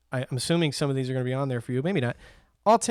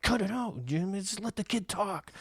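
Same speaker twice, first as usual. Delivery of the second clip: higher, softer Santa voice hushing the guy; imitating mall Santa; 5 semitones higher, 7 dB quieter than usual. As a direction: play the mall Santa, brushing him off. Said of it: "the Santa's like".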